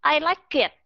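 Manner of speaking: "I like it" with a strong K starting "it", like "kit"; wrong over-linking. Shown as a demonstration. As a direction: push the K of "like" onto "it". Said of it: "nối những cái âm như thế này". ("I like it" nối âm K quá mạnh thành "kit")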